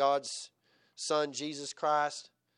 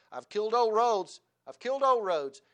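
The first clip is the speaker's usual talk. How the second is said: put-on triumphant boy; 7 semitones higher, 8 dB louder than usual.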